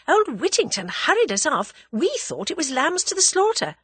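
high-pitched lively woman's voice; animated girl. A narrator's reading. Tuppence recounts being hurried off, animated. Tuppence tells of her ordeal.

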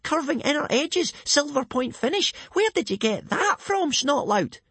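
put-on very high, gushing excited boy voice; excited kid. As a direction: gush like a starstruck kid. Speedyfist gushing over the sword.

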